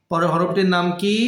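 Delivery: usual speaking voice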